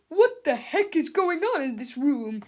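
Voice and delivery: loud angry principal bark; angry yell. A narrator's reading. Mister Krupp yelling at the class, angry. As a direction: yell like a furious substitute. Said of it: "he yelled".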